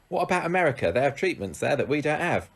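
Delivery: put-on high-pitched Cockney accent. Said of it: "That's my Cockney accent".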